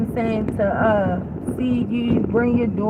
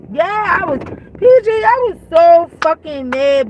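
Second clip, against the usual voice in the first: normal talking voice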